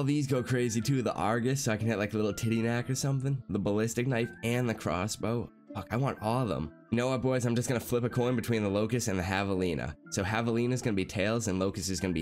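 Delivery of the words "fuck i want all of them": usual speaking voice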